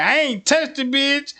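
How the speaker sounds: high, loud mock protest; a boyfriend yelling his innocence; denial. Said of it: "And he like".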